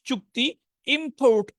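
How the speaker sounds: usual speaking voice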